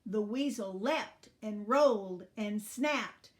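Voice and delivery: plain reading voice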